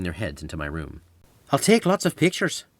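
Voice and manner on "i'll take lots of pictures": high bright Irish lilt; Irish accent. Acted. Sophia promising photos in a light Irish accent. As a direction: cheer him up in an Irish accent. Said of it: "in her Irish accent".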